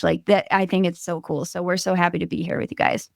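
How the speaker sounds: ordinary talk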